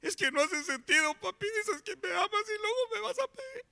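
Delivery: put-on high, far-off child voice, pleading; crying little boy. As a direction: play the small son protesting through tears. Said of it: "empezaba a llorar chiquito y dice".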